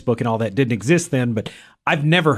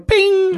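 robot bing; 5 dB louder than usual. Pretend. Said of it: "does she occasionally give any of the brr".

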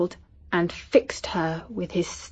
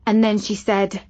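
plain reading voice

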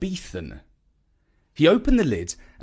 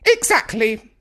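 snapped; 10 semitones higher, 4 dB louder than usual. A narrator's reading. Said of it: "she snapped".

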